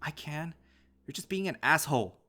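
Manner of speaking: furious youngster talking back; cracking yell. A narrator's reading. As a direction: yell back at your father, voice pushed up. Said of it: "I yell so loud my voice cracks".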